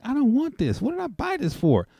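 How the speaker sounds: high, loud exasperated outburst; frustrated outcry. Retold or imitated. Woman quoting herself after buying a pack she didn't want, high loud frustration. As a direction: cry out in frustration at yourself. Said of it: "and I'm like".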